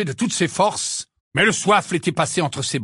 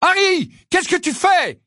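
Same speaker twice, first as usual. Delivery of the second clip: high, urgent scolding call; yelling; 13 semitones higher, 4 dB louder than usual. A narrator's reading. Angelina to a stalled Harry as she passes, yelling. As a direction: call out to a distracted teammate. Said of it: "cria Angelina".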